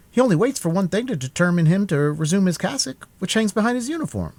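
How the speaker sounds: mocking musketeer pitched well up; a sly young musketeer; high musketeer voice. A narrator's reading.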